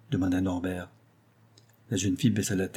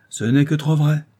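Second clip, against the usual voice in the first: higher, louder emphatic confirmation; firm affirmation; 5 semitones higher, 5 dB louder than usual. A narrator's reading. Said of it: "affirma Doman". (affirmation appuyée)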